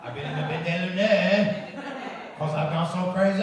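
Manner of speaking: loud hammed-up fake French phrase. Shown as a demonstration. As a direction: belt it with a fake French flourish. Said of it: "It's just very fun to do that".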